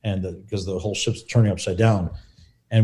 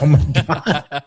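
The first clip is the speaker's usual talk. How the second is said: slow, laughing oh my God; drawn-out laughing gasp of fear; laughing dread; much slower than usual. Retold or imitated. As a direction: laugh through mock terror. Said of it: "I'm like".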